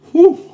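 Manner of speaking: short, loud, high hoot; sharp high "whew" at the hot food. Real, not acted.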